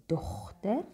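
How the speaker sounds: dochter with a throaty G, like loch; Germanic G. Shown as a demonstration. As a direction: scrape the G in dochter at the back of the throat. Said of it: "the Germanic G".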